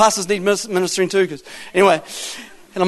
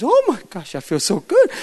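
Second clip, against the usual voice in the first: amazed relief. Retold or imitated. Healed woman answering the man who prayed, amazed. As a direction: act out her astonishment at feeling better.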